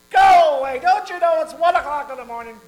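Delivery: loud, high annoyed shout; grumpy neighbor. Acted. Sleepy neighbor yelling at the man knocking, angry loud shout. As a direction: bellow him off from the window.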